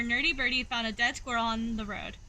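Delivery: ordinary delivery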